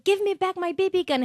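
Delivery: lightly voiced demand, pitched high; a hint of whiny bully yelling back; bully's demand. Jason demanding his gun back, lightly mimicked high whine. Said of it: "look around".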